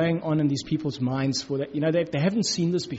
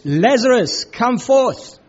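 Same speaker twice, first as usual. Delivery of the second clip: loud cry; 6 semitones higher, 8 dB louder, much slower than usual. A narrator's reading. Jesus calling Lazarus from the grave with a loud commanding cry. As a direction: call it out loud and high, commanding. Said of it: "he cried out with a loud voice".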